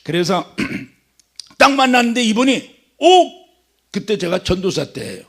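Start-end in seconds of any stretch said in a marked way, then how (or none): 3.0-3.5 a sudden high, loud "oh!" of recognition; an elder pastor's delighted oh; delighted exclamation. Retold or imitated. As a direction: burst out in happy surprise. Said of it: "딱 그러는 거야".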